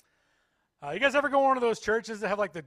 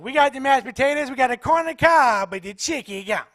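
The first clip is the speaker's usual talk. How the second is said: a lightly voiced chicken lady, a bit higher; 6 semitones higher, 7 dB louder than usual. Comic retelling the server's line, light chicken lady voice. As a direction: mimic her listing the food.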